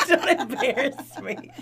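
slow, laughing mock whine; mocking echo. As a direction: mimic her plea, teasing. Echoes friend's plea mockingly.

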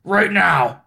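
insistent mock boss; lightly voiced commanding boss; Vince McMahon. Boss ordering a hesitant wrestler to strip, mock commanding impression.

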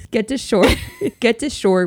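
laughter breaking up the words; laughing while reading. Real, not acted. Host reading out a wild listener story, laughing while reading. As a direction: try to read on through giggles. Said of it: "I can't even read it without laughing".

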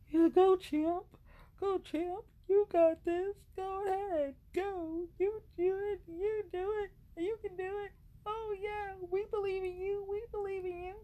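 very high squeaky cheering voice, held on one note; mock cheerleader. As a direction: cheer them on in a put-on falsetto. Mock pep talk, cheering on.

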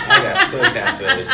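high squeal through laughter; laughing. Real, not acted. Laughing at own dating story.

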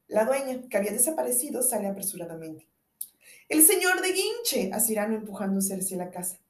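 normal talking voice

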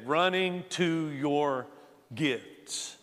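"Running to your gifts" slowed right down, falling low on "gifts"; drawn-out restatement, dropping soft on "gifts"; slow emphatic repeat. Shown as a demonstration. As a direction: let the theme sink in, word by word. Repeats theme slowly for emphasis.

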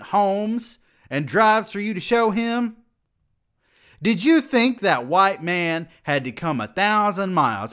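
high, loud rant rising to the end; furious tirade. A narrator's reading. Dean raging at the student for the slum visit, furious tirade. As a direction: pour out the outrage.

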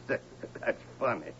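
soft unvoiced chuckle through the words; breathy laugh. Real, not acted. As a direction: laugh it off under your breath. Drunk laughs at own joke.

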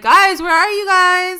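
high, drawn-out call to the others. Retold or imitated. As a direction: call out for your missing friends, imitating him. Voicing a character calling out for others.

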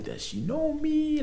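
drawn-out, very high incredulous question; shocked disbelief. Retold or imitated. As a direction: stretch it slowly, squeaking upward in disbelief. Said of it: "I was so shocked".

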